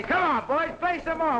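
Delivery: calling out to the band. Acted. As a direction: shout it over to the musicians.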